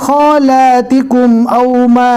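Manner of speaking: slow melodic tajweed chant; Quran recitation. Shown as a demonstration. Teacher reciting verses to the class, melodic and measured. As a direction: recite it, drawing out each verse.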